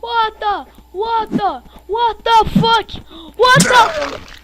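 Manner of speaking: rising loud high shock. Real, not acted. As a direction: shout it out, stunned. Shock building.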